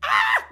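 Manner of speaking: high, loud burst of "ah"; shocked shout. Real, not acted. Reacting to the lady's savage comeback, shocked shout.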